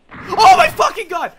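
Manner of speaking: high, very loud burst of fright; shocked shout. Real, not acted. Player startled by a death in the game, shocked shout. Jump scare.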